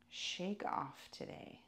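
ordinary delivery

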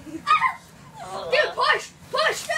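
loud, high laughing cheer; laughing shout. Real, not acted. Cheering during the Twister game.

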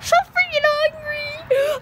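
put-on high, slow whiny baby voice. Kid slipping into a whiny baby voice over hunger. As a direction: stretch it out like a hungry baby. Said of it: "my baby voice in".

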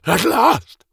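gleeful. Acted. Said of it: "laughing and capering like a child".

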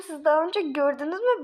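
usual speaking voice

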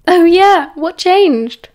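loud, high, grinning shout; yelling back. A narrator's reading. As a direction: yell it back teasingly with a grin. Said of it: "Sirius yelled back, grinning".

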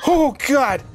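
loud, high startled cry; scared shout. Real, not acted. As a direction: yell out in real fright. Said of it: "that scared the crap out of me".

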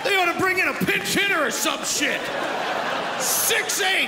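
high, exasperated comic shouting; outraged rant. Acted. Outraged rant about huge doctor.

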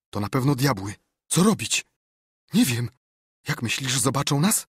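high, loud alarmed exchange; panicky fear. A narrator's reading. Boys sure that devils are coming, panicky fear. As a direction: blurt it out in rising panic.

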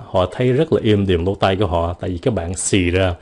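ordinary delivery

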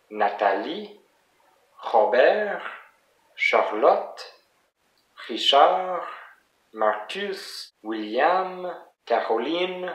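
French names, each stressed on its last syllable; French pronunciation. Shown as a demonstration. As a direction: list "Robert", "William", "Caroline" the French way, ending-heavy. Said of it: "it's always the last syllable that is the most stressed".

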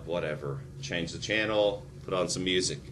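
soft, bored, tuned-out mumble; low, bored mock brush-off; bored dismissal. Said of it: "I'm like".

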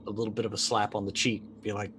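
ordinary talk

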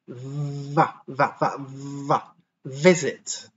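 repeated "v" buzzes leading into "visit"; V drill. Shown as a demonstration. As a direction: drill the V before the word. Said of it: "struggle to make the V sound".

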